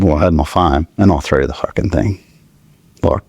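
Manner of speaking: ordinary delivery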